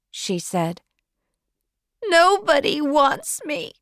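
put-on very high child's voice on the edge of tears; tearful girl. Girl tells Marilla nobody wants her, close to tears. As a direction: plead like a rejected child about to cry. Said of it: "She started crying very hard".

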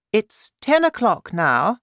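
high, regal voice of the Queen; Queen's voice. Acted. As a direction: speak up high like a worried queen.

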